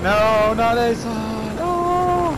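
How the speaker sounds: anguished gamer wail; dismayed. Real, not acted. Teammate is going down.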